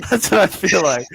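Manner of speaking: high giggle through the words; laughing. Real, not acted. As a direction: laugh while saying it. Laughing at own nerdy brag.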